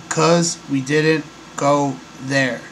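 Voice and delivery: slow quote with a pause after each word; gaps between words. Retold or imitated. Host showing the gaps in the astronaut's answer, halting and slow. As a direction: imitate his hesitant, gapped delivery. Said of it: "And the gap also".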